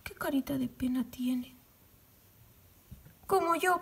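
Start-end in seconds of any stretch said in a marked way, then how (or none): 0.0-1.6 soft unvoiced whisper; much softer whisper; tender whisper. Real, not acted. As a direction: hush it fondly. Tender over the finished doll.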